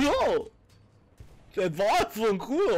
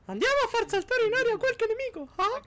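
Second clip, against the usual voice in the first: Mickey Mouse voice. Pretend. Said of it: "Eh, Mickey!".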